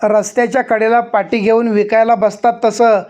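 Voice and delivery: mock the offer with rising heat. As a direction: sarpanch angrily scoffing at the compensation. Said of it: "आवाज चढवून सरपंच तावात बोलले".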